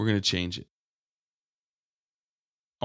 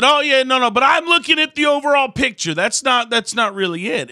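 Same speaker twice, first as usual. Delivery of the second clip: much higher, louder squeaky mimicry; high mock friend voice; 10 dB louder than usual. Preacher mocks the friend brushing him off, squeaky high. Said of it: "And he said".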